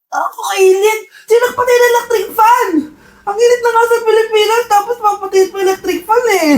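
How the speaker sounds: high, whiny wail about the heat; whining complaint. Acted. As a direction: whine like someone woken up sweltering. Wakes up hot, fan off.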